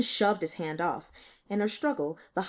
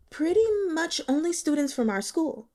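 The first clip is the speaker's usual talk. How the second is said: put-on student girl; 9 semitones higher, 3 dB louder than usual.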